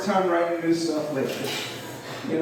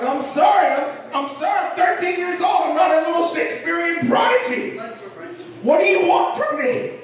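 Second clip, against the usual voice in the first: high-pitched, acted exasperation; exasperated protest; 8 semitones higher than usual. Thirteen-year-old defending himself to his mom, exasperated. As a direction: plead and protest, voice climbing.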